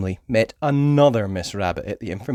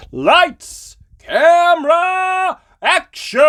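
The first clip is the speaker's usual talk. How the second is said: director's call; 8 dB louder, much slower than usual. A narrator's reading. Director calls the shot.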